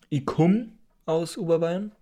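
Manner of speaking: "komm" as Bavarian "kumm", drawn out; slow Upper Bavarian, "ich komm" as "i kumm". Shown as a demonstration. Teacher voicing an Upper Bavarian, dialect "i kumm". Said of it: "der Oberbayer würde sagen".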